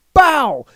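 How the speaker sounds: high, loud punched hit sound; mock impact noise. Telling how Logan gets hit in the stomach, mock impact noise. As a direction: burst out like a blow landing.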